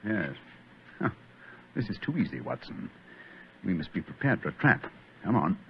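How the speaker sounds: soft, low sneaking voice; hushed whisper. Acted. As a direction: whisper so nobody hears. Sneaking through dark cellar.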